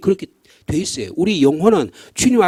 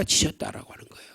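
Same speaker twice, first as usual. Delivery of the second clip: whisper; 9 dB quieter than usual. Acted. Hushed ending of point.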